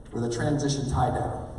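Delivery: ordinary delivery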